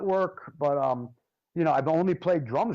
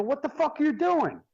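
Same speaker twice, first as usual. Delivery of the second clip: high, stunned what the fuck; shocked outburst; 9 semitones higher than usual. Retold or imitated. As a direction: blurt it out in disbelief. Shocked at drum being kicked.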